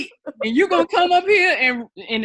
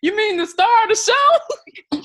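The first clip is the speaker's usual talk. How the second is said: mock grandeur; 8 semitones higher, 5 dB louder, slower than usual. Mock grand correction of friend.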